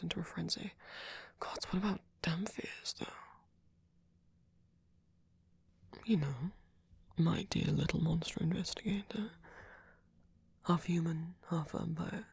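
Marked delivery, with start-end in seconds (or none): 1.4-12.2 whispering. Acted. Musing whisper about dhampirs.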